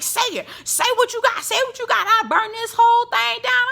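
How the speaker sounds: imitating Marceau's dare; mock Marceau threat. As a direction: dare her, high and heated. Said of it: "Marceau was like".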